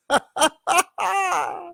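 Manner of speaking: high-pitched exultant laugh; joyful laugh. Acted. Daniel delighted to see Jesus, high joyful laugh.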